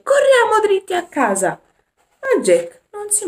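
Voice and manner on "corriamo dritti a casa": loud, much higher shouting. Acted. Alice shouts at the wolf to drive it away, loud high yell. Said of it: "urlò Alice".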